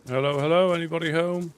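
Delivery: loud, high call into the house; calling down a hallway. Acted. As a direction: call out, hoping someone answers.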